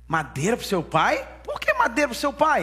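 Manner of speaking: put-on baffled boy. Boys questioning Sem about the wood, incredulous. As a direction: play an incredulous kid, pitch shooting up.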